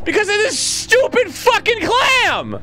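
high, loud furious yelling; swearing tirade. Real, not acted. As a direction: scream in rage at the game. Dying to clam, furious.

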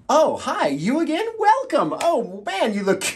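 high, loud greeting of feigned delight; mock surprise. Host welcoming a returning viewer, hammed-up surprise. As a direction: act thrilled to see them.

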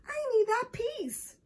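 put-on high-pitched, insistent child's protest; distressed cry. A narrator's reading. Michael protests as Rachel grabs the puzzle piece, distressed cry. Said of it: "cried Michael".

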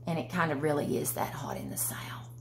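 whispered aside; hushed confidential aside. Real, not acted. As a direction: confide it under your breath. Confiding aside about the heat.

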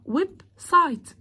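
"ويب سايت" as two separate beats; two split syllables. Shown as a demonstration. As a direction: break "ويب سايت" between web and site. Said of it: "ويب مقطع وسايت مقطع". ("ويب سايت" مقطع ثم مقطع)

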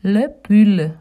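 le pull said with short u and light l; French pronunciation. Shown as a demonstration. Teaching children le pull in French. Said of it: "Sweater in French is".

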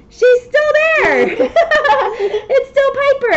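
high, loud, laughing delight. Real, not acted. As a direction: burst into giggles at the reveal. Child told hidden Piper still there.

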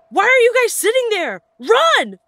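very high, loud, frantic shout; urgent yell. Retold or imitated. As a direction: yell back at friends who won't move. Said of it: "I looked back and yelled".

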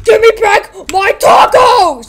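high, very loud, drawn-out yell; hammed-up outraged shout; furious demand. Figure robbed of tacos, furious stretched yell.

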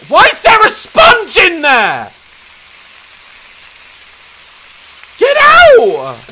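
high, loud indignant yelling; outraged shout. Real, not acted. Gamer yelling at a sponge in the pan, outraged. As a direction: bellow at the stray sponge.

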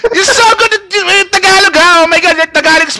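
hammed-up shrill praise of a friend's Tagalog; mock cheering. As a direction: overdo the praise to tease him.

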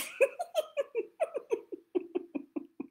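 squealing twin giggle; a long high giggle; giggling twins. Acted. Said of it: "They both giggled".

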